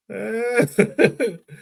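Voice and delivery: a quick high chuckle; brief amused laugh; short laugh. Real, not acted. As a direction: laugh at the thought. Laughing at his own joke.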